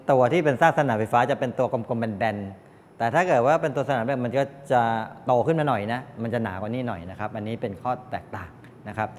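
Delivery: normal talking voice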